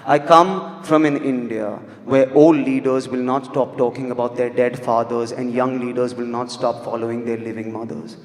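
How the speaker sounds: ordinary talk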